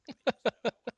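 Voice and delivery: high-pitched giggle; short burst of high laughter; laughing. Real, not acted. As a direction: giggle in a quick run. Laughing after Russian boy.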